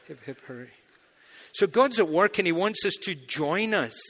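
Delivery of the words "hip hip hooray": muted repeat of cheer. Shown as a demonstration. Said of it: "repeat of the cheer".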